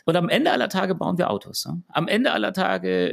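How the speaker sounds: usual speaking voice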